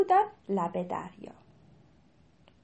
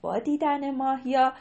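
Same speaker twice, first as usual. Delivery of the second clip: usual speaking voice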